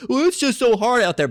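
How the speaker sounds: high mocking whine; mock whiny complaint. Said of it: "if you have to feel".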